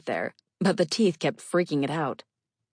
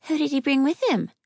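put-on high, anxious little girl; worried child voice; 10 semitones higher, 4 dB louder than usual. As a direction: ask like a frightened ten-year-old. Child familiar asking anxiously.

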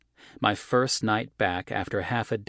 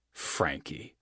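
sneered under the breath; scornful, low and soft; derisive mutter; 3 semitones lower, 5 dB quieter than usual. A narrator's reading. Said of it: "John said derisively under his breath".

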